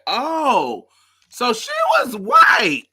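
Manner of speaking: high, loud, drawn-out discovery; astonished realisation. Retold or imitated. Viewer learning of her Italian parent, amazed and high. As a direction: squeal the realisation slowly, near laughter.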